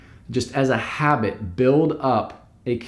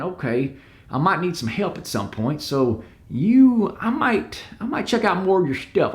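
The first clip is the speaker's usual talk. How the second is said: put-on Southern accent; 7 semitones higher than usual. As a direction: play a worried client with a Southern accent. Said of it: "why am I doing the Southern accent?".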